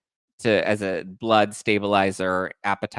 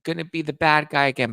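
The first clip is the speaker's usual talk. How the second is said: lightly voiced higher-pitched refusal; mildly mocking, raised-pitch protest; imitated protest; 7 semitones higher than usual. Host voicing a reality star's refusal, lightly imitated protest. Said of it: "And she's just like".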